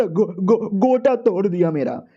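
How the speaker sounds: mock-hurt stutter, slowing down; pained stammer. Gangster hit in the groin complains, pained stammer. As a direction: stammer on the first syllable, then drag it out.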